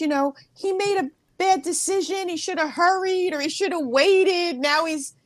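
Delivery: high, plaintive lament breaking into a laugh; comic retold grief; mock sobbing. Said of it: "I'm sobbing".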